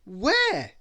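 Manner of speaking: high, loud shouted question. A narrator's reading. The lost Mister Men, desperate for dinner, shouting. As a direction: call it out together. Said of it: "everybody shouted".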